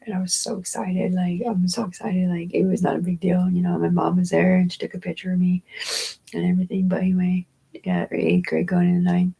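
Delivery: ordinary delivery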